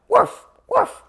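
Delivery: very high cartoon yip of a woof; reading a picture book aloud, the dog answers in a squeaky woof; dog barking. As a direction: bark it in a tiny toy-dog squeak. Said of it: "said dog".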